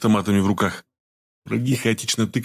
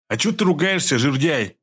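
offended; 5 semitones higher than usual. A narrator's reading. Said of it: "обиделся высокий и тощий Шнурок".

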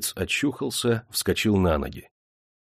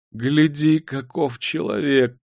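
raised, amazed exclamation; calling out; 6 semitones higher, slower than usual. A narrator's reading. Nephew points the lad out to uncle, crying out. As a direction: call out in wonder. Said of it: "крикнул племянник дяди".

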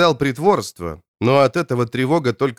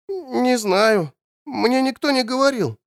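put-on high, small voice of a little boy; small child voice; 10 semitones higher than usual. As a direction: sound like a timid little kid. Child character voice.

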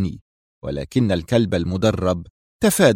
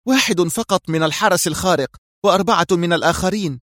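high-pitched, prompt female answer; prompt reply; 7 semitones higher than usual. A narrator's reading. Mira counts remaining men for the boss, prompt. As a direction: answer readily in a raised woman's voice. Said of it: "أجابته في سرعة".

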